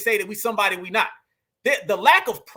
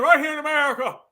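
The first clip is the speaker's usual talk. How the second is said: mock politician impression, pitched up; exaggerated presidential imitation, raised pitch; Obama; 4 semitones higher, faster than usual. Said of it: "talking like he's Obama".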